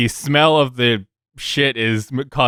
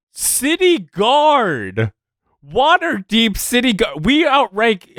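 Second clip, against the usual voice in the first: exasperated, as to idiots; 5 semitones higher than usual. Acted. Fergus lectures guards he thinks are idiots, exasperated disbelief. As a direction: start incredulous and high, settle into laboured patience. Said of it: "looks at them like they're idiots".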